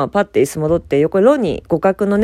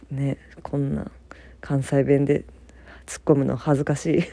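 embarrassed whisper; 4 semitones lower, 7 dB quieter than usual. Real, not acted. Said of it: "関西弁で 突っ込むの恥ずかしい".

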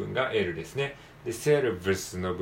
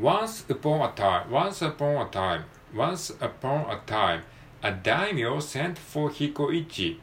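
slow, higher-pitched textbook story reading; story read-aloud; 5 semitones higher, much slower than usual. Shown as a demonstration. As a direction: model the tale for learners, phrase by phrase. Reads textbook story aloud as model.

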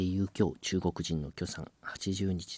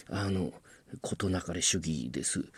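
ordinary talk, nothing marked

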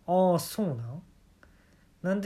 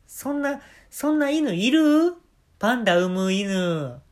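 incredulous; 6 semitones higher, 5 dB louder, slower than usual. Real, not acted. Reacting to absurd panda story.

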